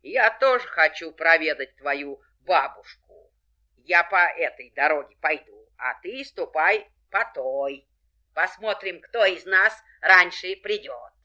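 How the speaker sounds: loud sly wolf voice; a cunning wolf challenging her. A narrator's reading. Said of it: "говорит волк".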